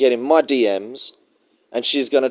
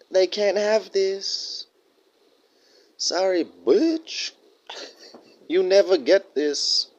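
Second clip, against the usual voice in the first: higher, slow, soft sing-song tease; teasing taunt; 8 semitones higher, 3 dB quieter, slower than usual. Acted. Taunting women who can't have him, high and slow. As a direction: dangle it out of reach, mocking.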